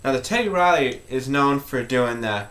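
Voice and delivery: usual speaking voice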